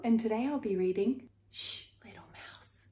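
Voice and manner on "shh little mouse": library whisper. A narrator's reading. Reading book title Shh.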